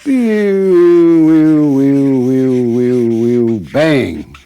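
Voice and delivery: loud whistling whooshes ending in a bang; mock rocket whoosh and bang; whooshing sound effect. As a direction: blow the whooshes loud then pop the bang. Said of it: "And you go".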